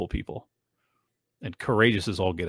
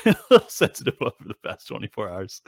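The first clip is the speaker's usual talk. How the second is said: laughing; 4 semitones higher than usual. Real, not acted. Laughing at own sensitivity.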